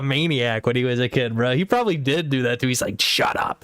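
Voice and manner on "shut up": voiceless, pretend threatening hush; hushed play-acted threat; mock menacing whisper. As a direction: whisper it like a bully silencing someone. Imagined bully silencing a child.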